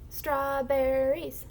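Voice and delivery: Strawberries with every syllable stretched out; slowly blended. Shown as a demonstration. Teacher blends syllables for the class, slowly blended. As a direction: draw Strawberries out slowly, joined up. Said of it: "the faster you say it".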